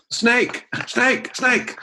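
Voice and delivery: high-pitched repeated alarm call; acted shout. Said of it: "then he shouts".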